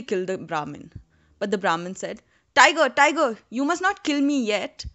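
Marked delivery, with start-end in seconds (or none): 2.5-4.9 urgent plea. A narrator's reading. Brahmin pleads urgently.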